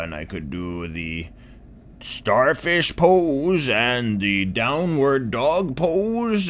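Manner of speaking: put-on high, slow, creaky old complainer; old tortoise voice. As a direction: groan it out like an aching old tortoise.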